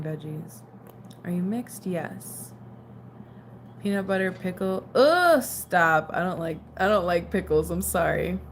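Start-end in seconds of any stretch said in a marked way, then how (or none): 4.9-6.1 loud, higher groan of disgust. Real, not acted. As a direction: recoil loudly from a gross food. Recoils at peanut butter with pickle.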